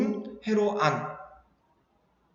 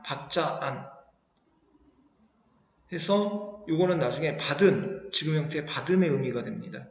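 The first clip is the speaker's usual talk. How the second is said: normal talking voice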